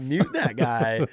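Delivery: slow, loud joking command; mock God command. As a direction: play a bossy God for laughs. Voicing God in a joke.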